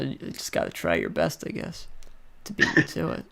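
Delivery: ordinary delivery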